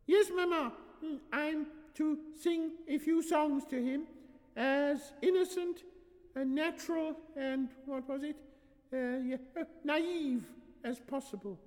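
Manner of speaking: put-on very high little-girl voice; young daughter. Daughter answering her mama, high childish recital.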